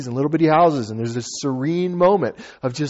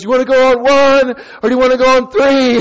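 loud, high shouted question; imitated shouting; 10 semitones higher, 6 dB louder than usual. Instructor to skydiver out on the wing, shouted question. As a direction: shout it over the wind.

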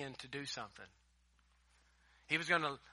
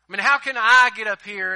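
pleading excuse; 5 semitones higher, 15 dB louder than usual. Retold or imitated. Acting out Gideon's excuses.

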